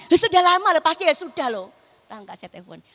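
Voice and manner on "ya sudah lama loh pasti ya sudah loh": louder, insistent back-talk to God; loud arguing. Retold or imitated. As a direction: argue back, refusing to give in. (membantah dengan suara keras)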